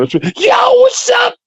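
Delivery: high, casual "yo" voice; code-switched street greeting. Shown as a demonstration. Switching to greet people from the inner city, casual. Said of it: "code switching is anything".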